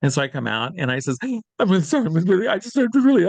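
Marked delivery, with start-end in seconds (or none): 1.2-3.3 teary apology. Retold or imitated. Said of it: "lips just a quivering and I got tears".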